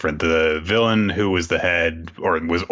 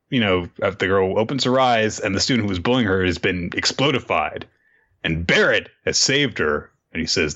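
normal talking voice

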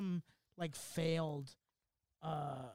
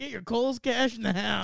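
mock ad slogan; 4 semitones higher, 6 dB louder, slower than usual.

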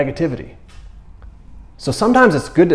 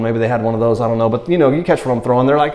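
ordinary delivery

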